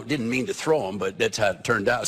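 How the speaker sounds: ordinary talk, nothing marked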